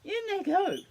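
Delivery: loud and rising into a high cheer; eager. Acted. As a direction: cheerfully throw it in. Dingo eagerly agrees.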